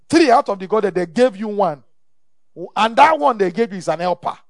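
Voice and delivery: loud, pressing rebuke; forceful preaching. Real, not acted. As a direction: drive the point home loudly.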